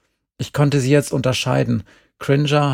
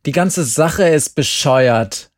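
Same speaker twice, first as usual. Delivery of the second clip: slow, loud and higher fed-up call; 4 semitones higher, 6 dB louder, slower than usual. A narrator's reading. Boy calling out to his friends, fed up and loud. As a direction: Glenn snaps that it's all stupid. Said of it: "rief Glenn".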